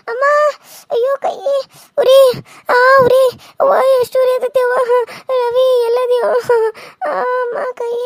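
high, loud overplayed wailing; fake crying. Daughter-in-law fakes a burn for her husband, loud fake wailing. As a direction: wail over the burnt hand, hamming it up. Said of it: "ಕೈ ಸುಟ್ಕೊಂಡು ನಂಗೆ ನಾಟಕ ಮಾಡ್ಬೇಕು".

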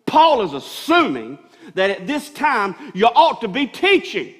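building sermon shout ending in a laugh; full-force preaching. Acted. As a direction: preach it hard to the congregation.

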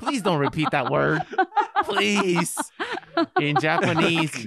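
pleading through laughter; laughing plea. Real, not acted. Begging friend not to repeat.

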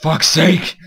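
loud, high burst of frustration; sudden real exasperated yell; exasperated outburst. Real, not acted. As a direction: shout it, fed up with your own fumbling. Fumbled German, exasperated outburst.

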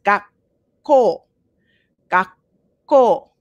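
"gakko" with a short stop before the K, ga-kko; double consonant pause. Shown as a demonstration. Teacher showing the double K pause in "gakko" to students. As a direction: hold a tiny catch before the k in "gakko". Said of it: "So you pause first".

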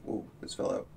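soft whispered aside. Real, not acted. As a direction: whisper it under your breath. Aside while crafting.

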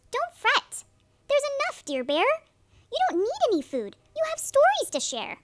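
very high, quick mouse voice; squeaky mouse. Acted. Mouse comforting Bear in a high squeaky voice. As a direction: squeak it fast and kind. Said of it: "Mouse squeaks".

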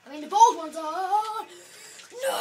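hammed-up loud shout; loud mock shout. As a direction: bellow it out in jest. Shouting zombie quip.

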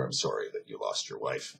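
low, soft condolence; softened, melted. Retold or imitated. Russian soldier consoling the widowed German, softened and sorry. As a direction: offer it quietly, voice dropped right down. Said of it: "kind of melted".

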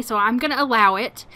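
usual speaking voice

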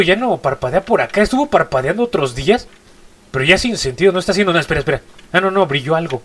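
ordinary talk, nothing marked

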